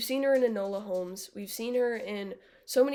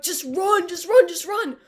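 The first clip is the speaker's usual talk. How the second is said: higher, louder urgent yell; 8 semitones higher, 9 dB louder than usual. Retold or imitated. Yelling at the TV for Max to run. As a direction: urge the character to run. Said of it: "I was screaming at my T V".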